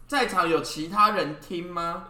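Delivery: ordinary talk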